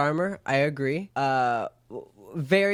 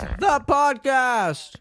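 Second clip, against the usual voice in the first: slow dramatic announcer; 10 semitones higher, 4 dB louder, much slower than usual. Acted. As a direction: drag out the show title. Dramatic show title tagline.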